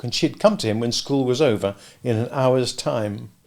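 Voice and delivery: plain reading voice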